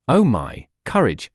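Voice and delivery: ordinary delivery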